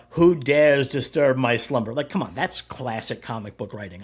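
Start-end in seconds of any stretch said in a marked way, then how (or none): 0.1-1.9 loud, slow, grand dragon-like boom; hammed-up ancient being roused; booming monster voice. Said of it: "she does, like, a".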